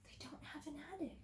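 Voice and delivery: breathless unvoiced whisper, a little quick. Real, not acted. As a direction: whisper it like a shared surprise. Teacher echoes twist in secret.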